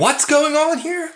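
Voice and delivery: very high, slowed-down mock panic; hammed-up bewildered man; shocked bewilderment. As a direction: squeal it slowly in disbelief. Acted shocked Larry hearing news.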